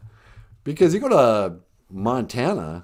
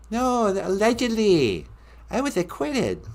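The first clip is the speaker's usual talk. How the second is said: [name]; 9 semitones higher than usual. Acted. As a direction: protest in a pop star's high voice. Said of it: "I'm the king of pop".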